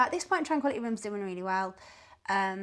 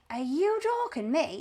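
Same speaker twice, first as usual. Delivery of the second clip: higher, drawn-out incredulous question; retold disbelief; 6 semitones higher, slower than usual. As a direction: act out a caller who can't believe it. Caller's disbelief, acted out.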